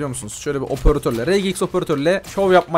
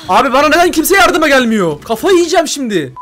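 louder and higher, fed up; frustrated yell; 10 semitones higher, 6 dB louder than usual. Real, not acted. Asking teammates why nobody helps, frustrated yell. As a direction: yell at the team for leaving you alone.

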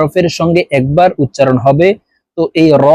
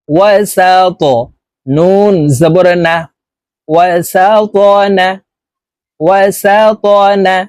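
slow, measured syllable-by-syllable recitation; deliberate teacher's model recitation; Quran spelling drill; much slower than usual. Shown as a demonstration. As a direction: spell out each letter evenly, then chant the joined word.

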